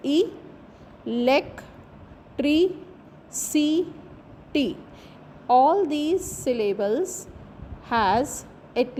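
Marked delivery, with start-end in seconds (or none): 0.1-4.8 ई, लेक, tree, सी, टी as five separate beats, long pauses between; syllable by syllable. Shown as a demonstration. Teacher counting five syllables of electricity, syllable by syllable. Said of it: "electricity has five syllables".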